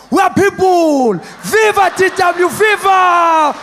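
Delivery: high, loud rally shout, slow; cheering crowd. Retold or imitated. Friends cheering, loud and slow, rally chant. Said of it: "They're like".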